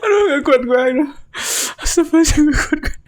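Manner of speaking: high, breathless laughing words; laughing so hard the belly hurts; laughing through pain. Real, not acted. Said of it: "sakit perut gue ketawa".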